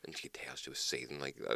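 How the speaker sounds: hushed whisper; whispered aside. Real, not acted. Telling his friend about the woman's anger, in a whisper.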